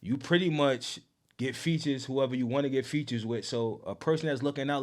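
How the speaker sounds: normal talking voice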